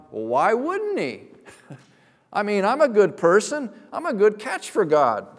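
lightly mocking, self-pleased voice; mock smug reply. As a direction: play the proud sinner who thinks he deserves it. Mocking self-righteous sinner's reply.

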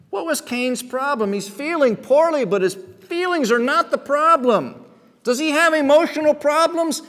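ordinary delivery

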